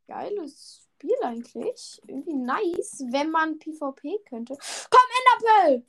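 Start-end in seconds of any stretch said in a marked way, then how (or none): 4.6-5.8 high, loud gamer yell; excited shout. Real, not acted. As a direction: shout it as you throw. Throwing ender pearl mid-fight.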